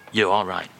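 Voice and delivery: ordinary talk, nothing marked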